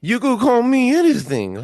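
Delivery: high, loud mock-Italian outburst; Italian accent. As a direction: belt it out in a hammed-up Italian accent. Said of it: "that was my Italian accent".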